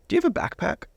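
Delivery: a hint of a curious pastor; youth pastor voice.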